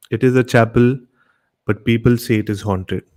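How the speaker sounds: usual speaking voice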